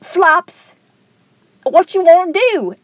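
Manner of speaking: cartoon vulture in a high [name] accent. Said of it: "like, a [name] accent".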